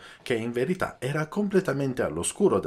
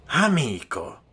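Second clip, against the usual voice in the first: shouted; 5 semitones higher, slower than usual. A narrator's reading. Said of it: "gridò lo Scamoggia".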